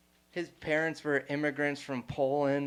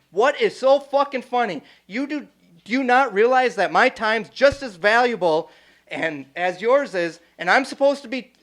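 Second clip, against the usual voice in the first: high, loud furious rant; mock yell; 7 semitones higher, 11 dB louder than usual. The big guy ranting about wasted time, high and loud. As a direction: yell it as the offended bouncer type. Retold guy yelling at him.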